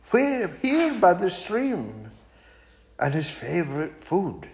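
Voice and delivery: high, polite little mouse turning down an invitation; small and prim, declining sweetly; a high, prim mouse fibbing to the owl. Acted. Mouse answers.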